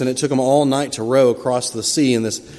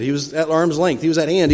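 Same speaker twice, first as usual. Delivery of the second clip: ordinary delivery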